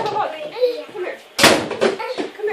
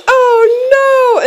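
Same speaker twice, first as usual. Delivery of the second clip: mock toddler wail, higher; toddler whimper; 6 semitones higher, 4 dB louder than usual. Mom retelling her toddler's meltdown, mock whimpering. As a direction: imitate the toddler's upset little cry. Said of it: "And then she goes".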